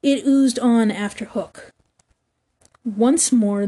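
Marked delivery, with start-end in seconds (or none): none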